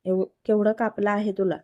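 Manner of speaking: usual speaking voice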